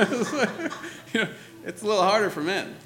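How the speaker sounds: amused admission through laughter; real laughing. Real, not acted. Preacher joking that empathy is harder for men, laughing.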